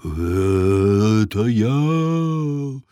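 slow, drawn-out windy hum; droning wind. A narrator's reading. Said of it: "прогудел ветер".

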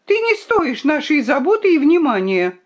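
high, heated reproach; angry cry. A narrator's reading. The fox disowns the ungrateful princess, shouting angrily. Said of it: "крикнула лиса".

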